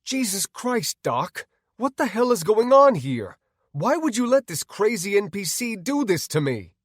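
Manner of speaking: high, loud protest; exasperated high yelling; outraged. Acted. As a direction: demand an explanation in outrage. Outraged man confronts the doctor.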